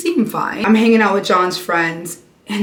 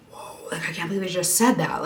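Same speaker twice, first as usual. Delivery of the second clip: breathless hushed whisper, quick; shocked whisper; 8 dB quieter, faster than usual. Retold or imitated. At the dinner table, stunned by friends, hushed whisper. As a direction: whisper it as if nobody at the table may hear.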